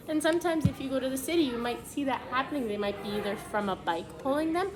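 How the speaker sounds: normal talking voice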